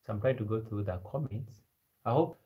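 usual speaking voice

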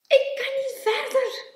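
high, loud little voice; calling out. A narrator's reading. Klein stranded on a stepping stone, crying out. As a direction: call out that you are stuck. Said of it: "riep Klein".

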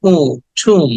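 long stretched "উ", then "ঠুম"; teacher lengthening "উ" inside "ঠুম"; drawn-out vowel. Shown as a demonstration. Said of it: "টেনে বলতে হবে".

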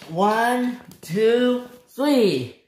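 high, drawn-out count. Acted. Stephen counting before he tries, high calling count. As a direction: call out each number before a heave.

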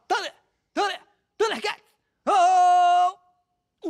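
brado de desenho animado, agudo e forte; grito de dublagem dos Thundercats. Shown as a demonstration. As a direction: solte o grito de guerra, esticando bem o final. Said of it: "Eu tinha que falar". (grito heroico de dublador em teste)

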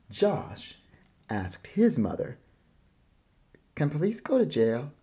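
plain reading voice

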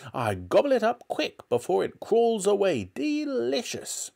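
gobble through it, then savour the last word; gloating grandmother. A narrator's reading. Grandmother relishing disgusting food.

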